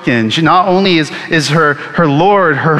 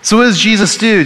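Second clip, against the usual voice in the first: normal talking voice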